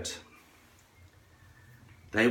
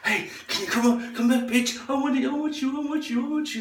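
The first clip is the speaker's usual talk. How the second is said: much higher, hammed-up whiny calling; mock pleading call. Guys beg the departing girl in a much higher whiny mock voice. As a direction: whine after the taxi, begging.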